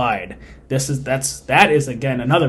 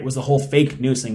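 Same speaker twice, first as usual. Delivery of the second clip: ordinary delivery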